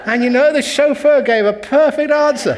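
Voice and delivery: loud laughing high through the words. Real, not acted. Monk laughs at his joke's punchline, loud high laughter.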